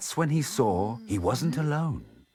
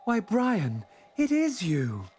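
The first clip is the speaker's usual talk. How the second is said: higher, gentle hedgehog brother; cheerful brother voice; 12 semitones higher than usual. A narrator's reading. Harold greets his long-lost brother, higher and glad. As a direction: sound delighted to find him.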